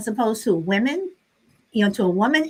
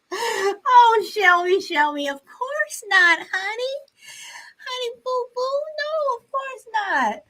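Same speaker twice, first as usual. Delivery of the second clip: high tender reassurance; 10 semitones higher, slower than usual. Real, not acted. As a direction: coo gently to calm her down. Reassuring a nervous viewer.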